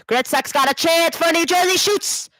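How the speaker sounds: high, rising call of a scoring chance; urgent live hockey call; excited play-by-play. Real, not acted. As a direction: build to a high-pitched shot call.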